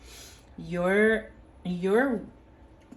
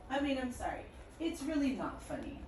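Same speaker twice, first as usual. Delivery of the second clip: high, hushed voice holding in a laugh; stifled laughter; 7 semitones higher, 6 dB quieter than usual. Real, not acted. Apologizing to listener after a laugh, trying not to laugh. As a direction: squeak out an apology through suppressed giggles. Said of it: "I'm sorry that I'm laughing".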